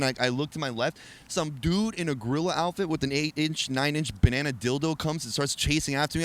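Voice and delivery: ordinary talk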